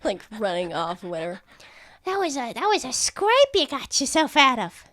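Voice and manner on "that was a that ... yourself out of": lightly high rat voice; a hint of squeaky rat. Rat NPC comments.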